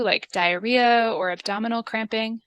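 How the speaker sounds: usual speaking voice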